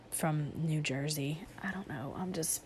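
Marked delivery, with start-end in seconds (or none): 1.6-2.6 whispering. Real, not acted. Trails into whisper.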